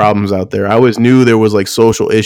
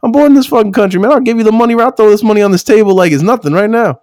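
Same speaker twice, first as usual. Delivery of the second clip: high, indignant inner rant; mock indignant; 10 semitones higher, faster than usual. [name] silently telling off the salesman, high and indignant. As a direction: puff up with offended pride. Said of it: "He's like".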